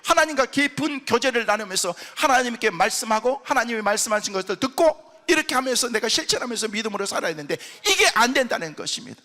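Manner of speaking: ordinary delivery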